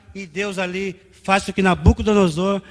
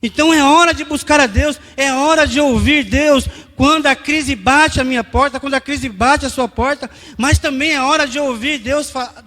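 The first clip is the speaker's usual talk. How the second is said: high, loud pulpit appeal; ringing, insistent sermon climax; full-force preaching; 6 semitones higher, 8 dB louder than usual. Real, not acted. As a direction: urge the congregation with raised voice.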